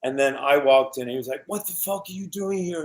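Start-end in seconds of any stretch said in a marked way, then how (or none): 1.5-2.8 higher, softer mock outburst; retold anger. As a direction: act out an angry challenge under your breath. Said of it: "he was so mad at me".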